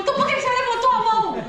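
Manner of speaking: high, quick mock challenge; exasperated pastor. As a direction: imitate a pastor's indignant comeback.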